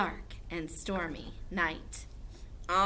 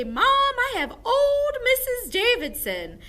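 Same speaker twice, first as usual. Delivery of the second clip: put-on high, loud child calling to mom; kid's high-pitched holler; excited kid; 8 dB louder than usual. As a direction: squeal it like a kid bursting in from school. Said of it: "when your kids come home from school".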